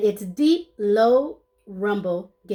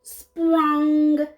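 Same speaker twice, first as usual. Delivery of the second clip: high springy pop; bouncy sound effect; 6 semitones higher, 3 dB louder than usual. Acted. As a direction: bounce the word up. Sound word in story.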